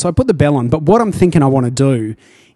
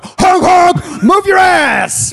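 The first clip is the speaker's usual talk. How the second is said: high, loud, slow yelling; brash clown honking at people; silly clown; 5 dB louder, much slower than usual. Retold or imitated. Rude horn-cyclist yell.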